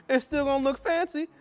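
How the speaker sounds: playful high voice. Acted. Covering the split burrito playfully.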